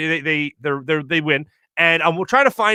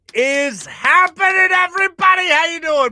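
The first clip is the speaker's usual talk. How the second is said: show host greeting; 11 semitones higher, slower than usual. Real, not acted. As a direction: belt it out to open the show. Radio show opening greeting.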